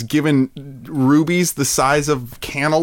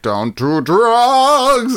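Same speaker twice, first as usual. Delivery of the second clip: monster voice; 9 semitones higher, much slower than usual. Pretend. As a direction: stretch it out slowly like a scary monster. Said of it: "I got a monster voice".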